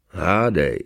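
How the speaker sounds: short, dismissive comeback; curt retort. A narrator's reading. Convict brushes off cellmate's question, curt. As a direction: cut him off with one word. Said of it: "odsekl recidivista".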